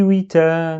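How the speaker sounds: "oui, ta" joined, no block, long a; "witaaa" with a single t; without shadda. Shown as a demonstration. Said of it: "comme si j'avais pas shadda".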